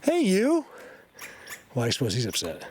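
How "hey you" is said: much higher, sing-song call to an animal; high coaxing animal-talk voice; pet voice. Real, not acted. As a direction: coo at a critter you want to come closer. Calling to a wild animal.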